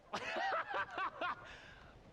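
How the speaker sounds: high, scornful cackle; mocking laugh. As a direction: laugh down at beaten John. Bill gloats over John.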